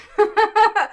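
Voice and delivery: high, loud, sudden laugh; burst of laughter. Real, not acted. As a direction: let a warm laugh burst out. Host laughs at emotional moment.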